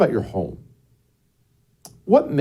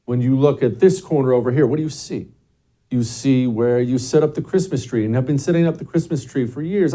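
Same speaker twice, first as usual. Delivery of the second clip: ordinary delivery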